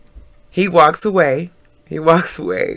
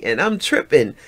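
ordinary delivery

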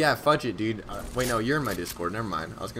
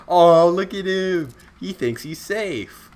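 high, loud, drawn-out teasing coo; mock cooing; 7 semitones higher, 9 dB louder, slower than usual. Streamer spots a player who thinks he's safe, mock cooing. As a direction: stretch it out, gloating over easy prey.

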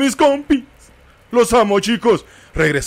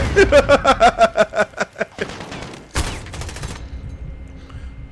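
breathless laugh; 7 semitones higher than usual. Real, not acted. Laughs at own grenade death.

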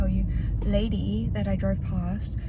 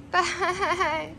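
high "bye" broken by laughter; laughing goodbye; 8 semitones higher than usual. Real, not acted. Waving friends off before the drive, laughing high. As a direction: laugh your way through the farewell.

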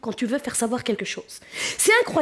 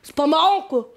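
annoyed spectator; 3 semitones higher than usual. Retold or imitated. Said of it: "il me dit".

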